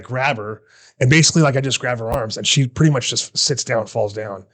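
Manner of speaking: usual speaking voice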